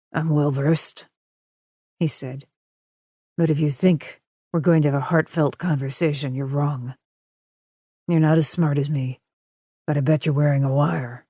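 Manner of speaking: a hint of a calm, controlling villain; deep smooth captor. A narrator's reading. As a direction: kidnapper giving orders, smooth and in control.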